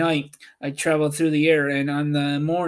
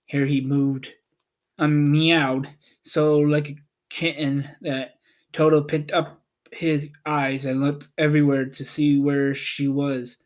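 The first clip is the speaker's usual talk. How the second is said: ordinary delivery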